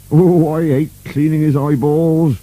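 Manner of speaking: high-pitched character grumble; grumbling servant. A narrator's reading. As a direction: moan about a hated chore. Burke grumbling about his job.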